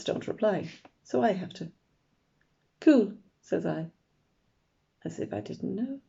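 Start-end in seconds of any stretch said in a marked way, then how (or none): 2.8-3.1 bright, loud "Cool" feigning indifference; a light show of not minding; feigned cool. A narrator's reading. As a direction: pretend you don't care.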